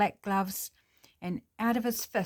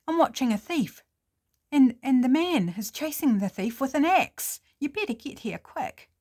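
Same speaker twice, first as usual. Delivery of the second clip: louder, hurried urgency; urgent report; 5 semitones higher, 6 dB louder than usual. Acted. Birdwatcher on the phone to police, louder urgent plea. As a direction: press the police to hurry.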